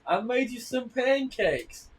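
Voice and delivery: high, slow, sing-song wife voice; drawn-out high sweetness, like a TV housewife; slow high cheery wife offering pancakes; mock sitcom wife. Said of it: "he's like".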